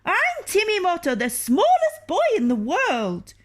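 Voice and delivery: put-on tiny proud boy. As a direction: announce yourself proudly in a little voice. Tiny boy announces himself.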